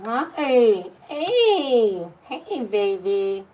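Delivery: high, loud excited greeting. Real, not acted. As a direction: squeal hello to a pet. Greeting her pet excitedly.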